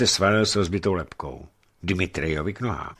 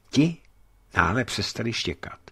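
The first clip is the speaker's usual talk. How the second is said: usual speaking voice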